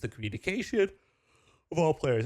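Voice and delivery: yawning through words. Real, not acted. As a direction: talk mid-yawn. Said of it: "I'm sorry I'm yawning".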